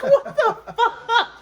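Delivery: slow, high, laughing "What the fuck?"; laughing disbelief. Retold or imitated. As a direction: stretch it out while laughing.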